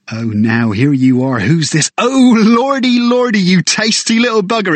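a guide thrilled by a cheeky crow; excited bird guide. Acted.

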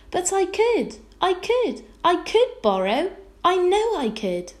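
high, loud excited pleading; a child's breathless-to-prove-it protest, high and loud; eager insistence. A narrator's reading. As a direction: insist you can, voice shooting up. Girl insists against mother's shock.

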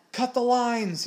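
high, loud urgent command; alarmed full-voiced shout; bellowing. A narrator's reading. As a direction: bellow the order to the crew. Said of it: "he bellowed".

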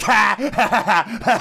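high, hammed-up fake laughter; mocking Jimmy Fallon laugh. Mocking a talk show host's laugh, hammed-up fake laughter. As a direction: ham up a host's forced laugh. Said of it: "making fun of Jimmy Fallon".

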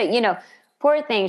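ordinary delivery

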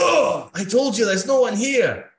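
high, loud pained priest after a punch. Acted. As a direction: cry out as if just punched. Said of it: "he's like".

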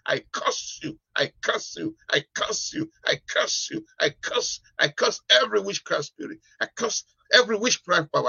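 fiery preacher rebuking spirits, high and rising; laying a curse. Real, not acted. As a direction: curse them with full force. Cursing witchcraft spirits.

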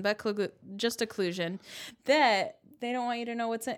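ordinary talk

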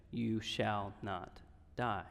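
slow and soft, almost reverent; hushed solemn quote. Acted. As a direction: lay the promise down gently and slowly. Quoting the Lord through Nathan.

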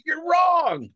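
high playful shout of protest; mock outraged yell. Co-host play-threatens the other over his album verdict, mock outraged yell. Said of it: "punch you in the face and say".

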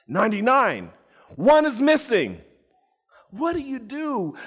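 high and loud, one missing; alarmed count. Acted. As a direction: sound the alarm over the missing sheep. Dramatic count of the sheep.

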